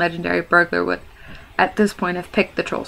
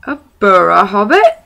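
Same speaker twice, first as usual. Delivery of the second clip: puzzled troll; 2 semitones higher, 9 dB louder than usual. Acted. Trolls repeat the strange word.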